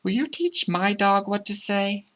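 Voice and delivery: put-on high child's voice asking for help; high, singsong child's request; child calling out. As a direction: ask sweetly in a high kid's voice. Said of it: "the child called out".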